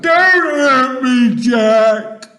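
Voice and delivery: long, high, frightened wail; pleading yell. A narrator's reading. Giant begging Jack not to hurt him, yelling. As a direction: yell it as a desperate plea. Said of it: "he yelled".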